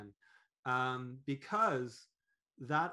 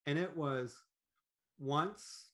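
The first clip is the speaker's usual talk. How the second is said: ordinary talk, nothing marked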